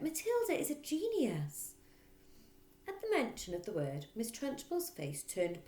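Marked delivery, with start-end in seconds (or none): 0.0-1.7 higher, lightly excited; teacher praising her pupil; eager claim. Acted. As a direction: announce it proudly. Miss Honey eager.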